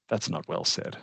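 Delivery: whispered under breath; muttered aside. Real, not acted. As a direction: say it to yourself, very soft. Self-critical aside.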